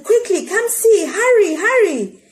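put-on high, loud child's call; girl calling her mother to come see, shouting. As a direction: call out excitedly for Ma. She shouted.

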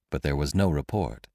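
ordinary delivery, nothing marked

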